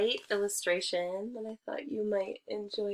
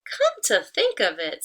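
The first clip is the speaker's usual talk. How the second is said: louder, knowing hen voice; 8 dB louder than usual. Acted. Hen realising the trick, knowing. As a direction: catch on, knowingly. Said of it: "said Hen".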